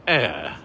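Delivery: posh AIR through closed teeth; clenched-teeth posh. Shown as a demonstration. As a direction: AIR falling low with the mouth nearly closed. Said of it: "with your mouth closed".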